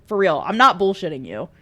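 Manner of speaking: normal talking voice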